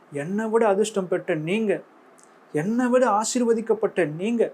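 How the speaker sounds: normal talking voice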